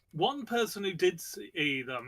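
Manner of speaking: ordinary talk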